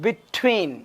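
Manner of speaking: between, leaning hard on the T; stress on the T. Shown as a demonstration. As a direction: punch the T that opens the second half of between. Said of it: "टी के ऊपर जोर दिया जाता है". (between में टी पर ज़ोर)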